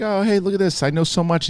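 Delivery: high, chirpy mock tour guide showing off; eager tour guide. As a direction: lightly play the overeager museum nerd. Mimicking eager would-be tour guide.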